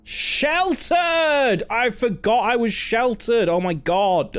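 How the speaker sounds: joyful surprise at being sheltered; excited realisation. Real, not acted. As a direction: shout it as the good news hits. Realises room was sheltered.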